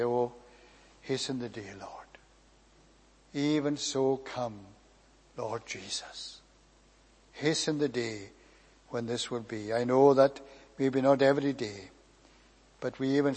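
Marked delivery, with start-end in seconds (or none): 0.0-9.7 hushed prayer. Retold or imitated. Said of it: "we say".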